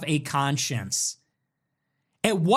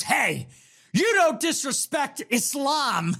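higher, slow scolding voice; mocking Tucker; 7 semitones higher, slower than usual. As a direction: parody a stern lecturer wagging a finger. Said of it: "He goes".

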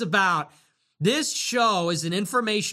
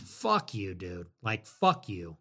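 contemptuous low; 11 semitones lower, 6 dB quieter, slower than usual. Real, not acted. Scorning a super chat.

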